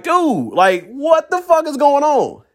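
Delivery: high, slow, incredulous dude; baffled outrage. Retold or imitated. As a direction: drag out the disbelief. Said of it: "I'm just like".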